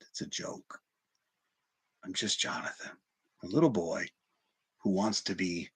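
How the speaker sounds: voiceless, barely audible confession; whispered admission. Real, not acted. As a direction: drop the mask and breathe it out. Raw admission after laugh.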